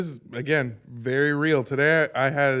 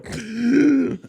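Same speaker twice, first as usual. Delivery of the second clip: imitated waking gasp; 7 semitones higher than usual. Co-host showing how she woke each time, sharp gasp. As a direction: gasp as if jolted out of a nightmare. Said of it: "I'd go".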